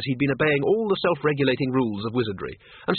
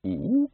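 short, high, rising hoot; questioning ape ooh; 6 semitones higher than usual. Acted. The librarian answers Windle's call, questioning hoot. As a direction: answer with an inquisitive ape noise.

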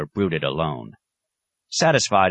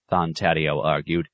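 ordinary delivery

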